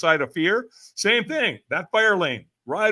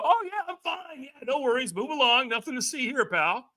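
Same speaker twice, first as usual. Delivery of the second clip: mock breezy brush-off, higher; fake reassurance; 8 semitones higher, 4 dB quieter than usual. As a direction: pretend all is fine. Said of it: "We go".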